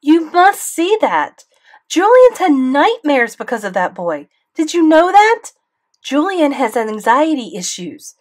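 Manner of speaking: loud, high angry tirade; mother ranting at the school board, loud fury; furious rant. A narrator's reading. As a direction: tear into the principal. Mom keeps ranting.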